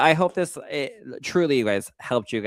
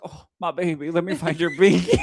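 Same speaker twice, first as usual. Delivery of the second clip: put-on baby talk; 7 semitones higher, 4 dB louder than usual. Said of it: "I was like".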